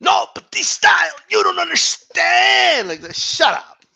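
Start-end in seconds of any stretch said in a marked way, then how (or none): 0.0-2.9 mock a crybaby fan's pleading, slowing at the end; whiny mock fan. Mocking whiny boxing fans.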